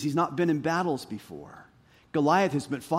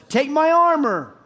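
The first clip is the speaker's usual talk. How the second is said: eager king; 7 semitones higher, 6 dB louder than usual. Retold or imitated. King Saul offers his armor.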